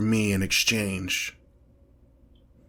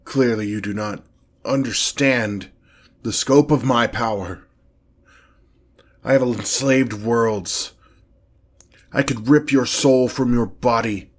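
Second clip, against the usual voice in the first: loud, commanding boast of power; each word driven home forcefully; imperious demon threat; 5 dB louder than usual. Acted. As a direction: proclaim your might to a puny mortal. Demon threatens mortal summoner.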